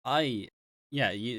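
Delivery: ordinary talk, nothing marked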